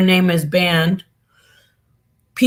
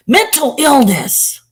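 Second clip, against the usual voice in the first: scornful jab; 7 semitones higher, 4 dB louder, slower than usual. Real, not acted. Scornful verdict on critic.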